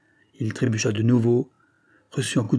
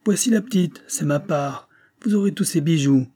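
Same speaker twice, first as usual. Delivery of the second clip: high shout across the river; calling out; 6 semitones higher than usual. A narrator's reading. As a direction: call to the rowers to spur them on. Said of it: "Il cria aux deux rameurs".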